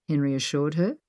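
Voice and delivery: ordinary delivery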